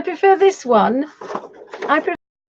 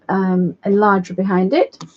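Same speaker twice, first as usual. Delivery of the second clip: ordinary talk, nothing marked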